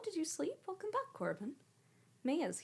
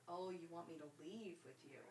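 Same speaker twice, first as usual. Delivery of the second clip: soft pet talk; 2 semitones lower, 12 dB quieter than usual. Real, not acted. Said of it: "soft to cat".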